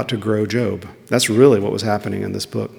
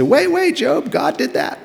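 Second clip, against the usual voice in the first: high, squeaky mocking voice; mock objector. Mocking a listener who corrects Job, high. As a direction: parody the nitpicking listener up high. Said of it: "You say".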